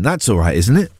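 ordinary delivery

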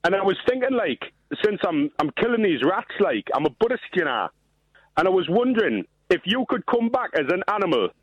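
put-on Geordie accent, matey caller; dodgy Geordie accent. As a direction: lay on a shaky-quality Geordie accent. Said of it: "better than your accent is".

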